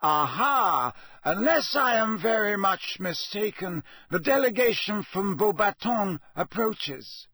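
loud "aha", then a high, carrying announcement; calling out. A narrator's reading. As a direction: announce it over a crowd, high-pitched. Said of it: "Dumbledore called out from the back row".

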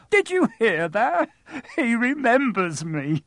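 high, slow, delighted crowing; thrilled little man. Acted. Diggle boasting to the pub, high and thrilled. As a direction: show off to everyone.

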